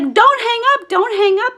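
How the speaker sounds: very high, urgent and loud; panicked plea. Retold or imitated. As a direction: beg urgently before the caller is gone. Retold plea to keep sibling on phone.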